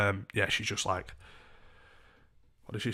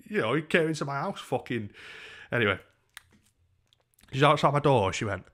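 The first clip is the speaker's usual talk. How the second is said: ordinary delivery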